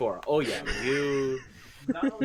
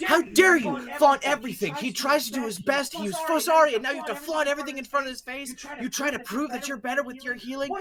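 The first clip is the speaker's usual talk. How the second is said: high, furious scolding voice; a shrill-free, high indignant rant, rushing; angry elf cleric; 12 semitones higher, 5 dB louder, faster than usual. Acted. Elf cleric scolding Basil after slapping him, high indignant rant. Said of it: "she goes".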